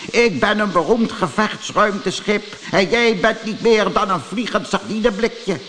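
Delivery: high, pompous bragging voice; puffed-up, scornful character voice; majoor Klets. Acted. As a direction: belittle the small fry from on high. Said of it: "riep majoor Klets".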